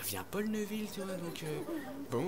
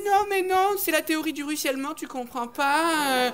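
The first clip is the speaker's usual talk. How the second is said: put-on much higher, insistent girl voice; girl's protest; 8 semitones higher, 5 dB louder than usual. Girl caught stealing, shrilly justifying herself. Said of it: "Elle me dit".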